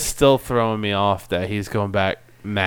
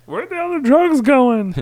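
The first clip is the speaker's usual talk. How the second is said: high mocking repeat; 4 dB louder than usual. Cohost repeats the bit high.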